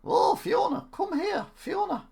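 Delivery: very high, loud, badly faked ogre voice; Shrek. Streamer retrying Shrek calling Fiona, high silly impression. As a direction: squeal it out as a failed Scottish ogre. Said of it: "That wasn't Shrek".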